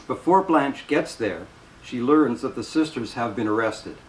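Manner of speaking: ordinary delivery, nothing marked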